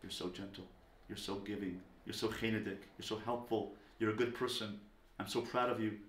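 very soft, low and tender; hushed, intimate loving tone; whisper in the ear. Acted. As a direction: murmur praise privately to your child. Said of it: "I give them a whisper in their ear".

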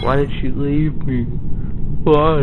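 high, loud mock despair, pitch climbing on the last why; hammed-up wail of abandonment; mock whining. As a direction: whine it like a dumped kid, overdone. Mock whine after she leaves.

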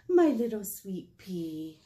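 slow, fond auntie voice; doting auntie. Acted. Auntie arriving to fetch child, slow doting pet name.

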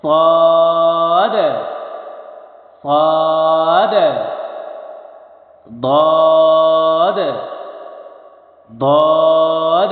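صاد and ضاد called out loud and held very long; loud drawn Arabic letters. Shown as a demonstration. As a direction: project each letter slowly and fully. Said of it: "হরফের উচ্চারণগুলো লক্ষ্য করবেন". (জোরে টেনে হরফের উচ্চারণ)

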